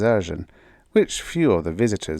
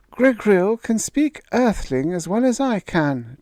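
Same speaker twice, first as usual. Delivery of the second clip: put-on higher, earnest young daughter; a daughter defending her fiancé, pitched higher; young woman's voice; 12 semitones higher than usual. A narrator's reading. Daughter defending Gregrill to her father, higher young voice.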